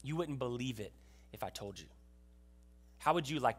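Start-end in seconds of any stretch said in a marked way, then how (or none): 0.0-1.9 much lower and softer, solemn; the Lord's grave answer. Acted. The Lord answering Habakkuk's complaint, low and grave. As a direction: answer quietly, heavy and ominous. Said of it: "the Lord looks back at Habakkuk and says".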